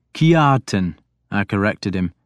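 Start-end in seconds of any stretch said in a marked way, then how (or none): 0.1-1.0 Kiartan said as Kyartan, J like Y; Kiartan with a Y sound. Shown as a demonstration. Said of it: "making the J sound like a Y".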